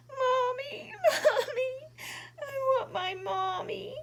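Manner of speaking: high, loud mock wail; crying for mommy. Little bird crying loudly for his mommy, high and wailing. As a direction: wail like a lost little chick. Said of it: "he started to cry".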